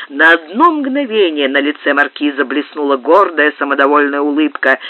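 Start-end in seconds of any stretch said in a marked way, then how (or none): none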